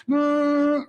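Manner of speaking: high, strained "mm"; groan of pressure. Acted. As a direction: let out a loud, high hum of pressure. Sound of piling pressure on yourself.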